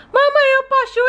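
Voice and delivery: put-on high childlike voice of a small daughter; little girl voice. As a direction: play the sick little girl talking to mom. Doll asks.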